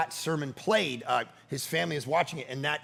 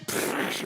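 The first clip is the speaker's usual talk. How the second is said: mouth-made burst of spraying fizz; short whooshing splatter noise; imitated spray. Dad mimicking daughter's spilled can in his truck, imitated spray.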